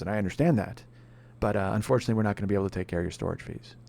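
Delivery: low, soft, unbothered agent voice; customer-service drone brushing off an angry customer; bored insurance agent. Retold or imitated. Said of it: "ho-humming the shit out of me".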